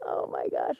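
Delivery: unvoiced whisper. Real, not acted. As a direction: breathe it in a hush. Hushed during hug.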